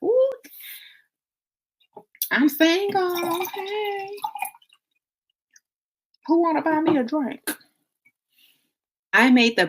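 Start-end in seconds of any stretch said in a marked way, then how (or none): 0.0-7.4 high, slow sing-song celebration; giddy single. Acted. Woman celebrating breakup night out, high giddy call. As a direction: squeal it like freedom just arrived.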